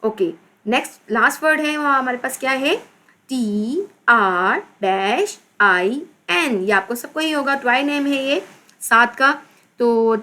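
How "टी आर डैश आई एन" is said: slow, drawn-out letter names; spelling out. Shown as a demonstration. Teacher spelling with a blank. (धीरे-धीरे, खींचकर हिज्जे)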